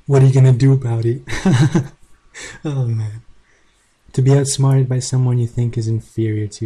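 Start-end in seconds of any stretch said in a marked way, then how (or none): 0.1-1.2 loud mocking challenge; loud taunt. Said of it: "I even tell him". 1.3-1.9 gloating laugh. Real, not acted. Laughing at outsmarted opponent.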